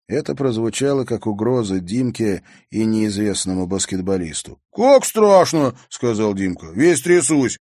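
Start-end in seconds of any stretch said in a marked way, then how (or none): 4.7-5.7 high, loud, drawn-out sarcasm; slow, exaggerated scare; mock fright. A narrator's reading. Mock fear at a bully's threat. 6.7-7.6 loud, high jeer; sarcastic exclamation; mock fright. A narrator's reading. Mock fear at a bully's threat.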